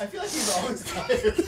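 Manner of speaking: giggling high through the words; laughing. Real, not acted. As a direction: laugh while talking. Laughing at the bit.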